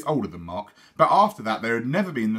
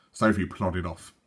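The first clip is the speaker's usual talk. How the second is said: plain reading voice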